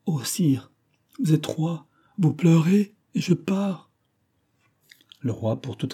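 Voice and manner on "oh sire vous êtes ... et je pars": breaking from hushed to high; high-pitched, anguished farewell; anguished cry. A narrator's reading. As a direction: plead in heartbroken disbelief. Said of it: "s'écria avec un accent que rien ne pourrait rendre".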